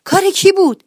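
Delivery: high, loud angry yell; angry shout. Acted. Uncle Shito yells at the kids over broken vases, angry shout. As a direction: shout out who did it, furious. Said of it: "عمو شیتوی عصبانی داد زد".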